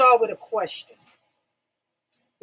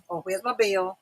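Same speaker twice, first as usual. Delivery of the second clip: ordinary talk, nothing marked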